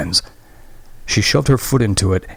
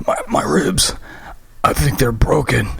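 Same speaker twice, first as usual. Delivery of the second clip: pained gasp; 6 semitones higher than usual. Acted. Graham injured, in pain.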